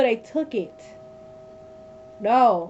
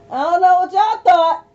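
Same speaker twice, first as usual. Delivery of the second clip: louder, higher, slowed while laughing; 6 semitones higher, 7 dB louder than usual. Real, not acted. Streamer laughing at viewers, loud and amused. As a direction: laugh it at the chat.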